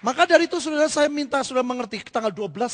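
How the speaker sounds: loud, fast preaching call; rally the congregation, loud and fast; preaching at full force. Acted. Preacher urges the congregation to come to the rally, loud and fast.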